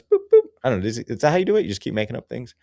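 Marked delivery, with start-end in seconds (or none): 0.0-0.4 two very high, loud boops; high nose-tap boops. Pretend. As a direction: squeak out playful tap noises. Imitated nose-tap in a children's song.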